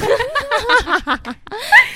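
rising burst of laughter; long laugh. Real, not acted. Laughs at own joke.

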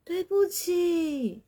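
apologise, cringing with shame; embarrassed apology. Real, not acted. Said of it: "超难为情的".

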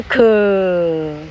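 可 drawn out as a long "kuh"; voiced k syllable. Shown as a demonstration. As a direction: stretch 可 into a full "kuhhh". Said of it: "不要念出来". (把“可”拖长念出来)

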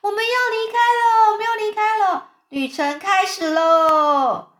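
high, loud hurrah; jubilant cheering. A narrator's reading. Everyone cheers as the journey starts, jubilant. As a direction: cheer long and loud, stretching the ending. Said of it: "大家就一起欢呼".

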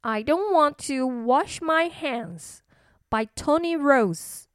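plain reading voice

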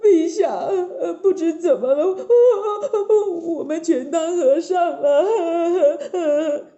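high, sobbing lament to the king; hammed-up crying, drawn out at the end; weeping servants pleading; tearful wailing. A narrator's reading. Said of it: "含着眼泪说".

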